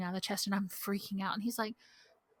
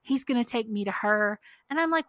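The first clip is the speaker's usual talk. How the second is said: ordinary talk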